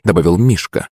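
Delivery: ordinary delivery, nothing marked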